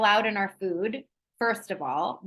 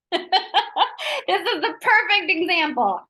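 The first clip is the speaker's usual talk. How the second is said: 1 laughing; 9 semitones higher, 5 dB louder, slower than usual. Real, not acted. Laughs at own kids' snack.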